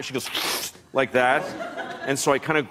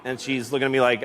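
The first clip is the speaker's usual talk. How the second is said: ordinary delivery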